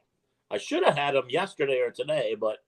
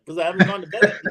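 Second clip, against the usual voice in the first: laughing; 3 semitones higher, 6 dB louder, faster than usual. Retold or imitated. Laughing at his own retold reply.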